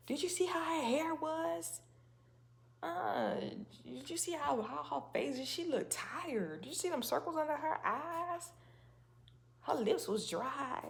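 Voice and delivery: high, catty gossip voice, lips pursed; gossiping critic. Retold or imitated. Mimicking jealous gossipers who pick her looks apart, high and catty. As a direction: mimic a petty gossip picking someone apart.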